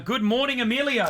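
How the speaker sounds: high bright sing-song hello; sing-song greeting. Real, not acted. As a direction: greet her like a cheery morning host. Cheery radio host greeting.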